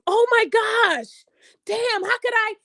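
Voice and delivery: slow, high hammed-up panic; mock panic. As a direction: fake the panic, drawn out and high. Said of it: "see her panicking".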